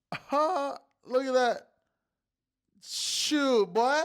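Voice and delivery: high, loud crowing over a win; gleeful whoop. Real, not acted. Host showing chat tonight's winning bet, crowing loudly. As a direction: whoop like you just cashed out. Shows another winning slip.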